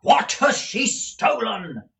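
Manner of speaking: loud, high, slow urgent question; agitated usurer insisting on an answer; agitated demand. A narrator's reading. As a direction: demand it loudly, each word stretched. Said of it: "his frame trembled with agitation".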